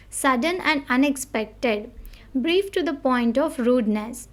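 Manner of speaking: normal talking voice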